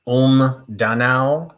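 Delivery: German town names with improvised pronunciation, rising at the end; guessed German pronunciation. Real, not acted. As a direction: tentatively sound out each German place name, separately. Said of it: "I'm just making stuff up now".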